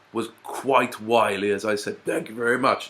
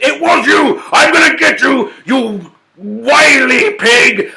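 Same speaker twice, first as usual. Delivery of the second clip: loud, much higher furious shout, slowing on the last words; furious wolf; 11 semitones higher, 8 dB louder, slower than usual. Acted. Wolf realising the pig tricked him, shouting in fury.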